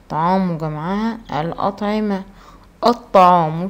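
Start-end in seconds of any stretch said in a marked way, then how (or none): 2.9-3.7 الطعام with the lam left silent, straight into a doubled ط. Shown as a demonstration. Teacher showing third-graders the sun lam, الطعام with silent L. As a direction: say الطعام loudly, no L sound, the ط doubled. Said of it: "شوف أنا ما نطقتش اللام".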